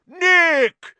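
booming high roar of greeting. A narrator's reading. Sir Patrick hailing Nick at the party, roaring. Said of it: "he roared".

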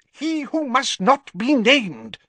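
high, slow snarl as Fudge, hissing the forbidden title; snarled warning. Acted. Fudge snaps at the Prime Minister not to say the name, slow high snarl. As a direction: bite off each word like a forbidden name. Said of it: "snarled Fudge".

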